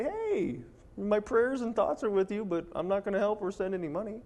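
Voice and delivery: high, breezy mock sympathy; mocking quote. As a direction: toss it off way up high, hollow and cheerful. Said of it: "people just wanna say".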